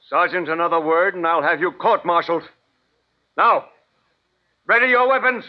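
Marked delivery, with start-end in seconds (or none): 3.4-5.4 shouted order. Acted. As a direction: force the order through. Colonel orders troops.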